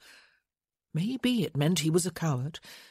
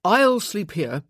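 forceful insistence; 4 semitones higher, 7 dB louder, slower than usual. Acted.

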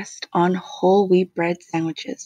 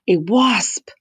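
cry; 5 dB louder than usual. A narrator's reading. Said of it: "she cried".

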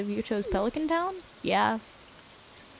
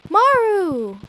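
loud, higher drawn-out call; excited call; 9 semitones higher, 11 dB louder than usual. Real, not acted. Spotting Maru in the game, excited call. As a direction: call out in delight after a gasp.

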